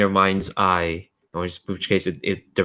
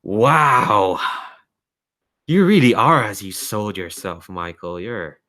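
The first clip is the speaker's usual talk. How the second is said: lightly voiced mocking entity, fading to a whisper; amused otherworldly voice. Mysterious voice praising Michael's strength, amused and trailing to a whisper. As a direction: open on an admiring "wow", then sink to a breath. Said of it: "you hear this voice just laughing, just saying".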